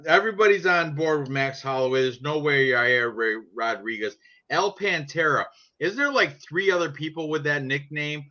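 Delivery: ordinary delivery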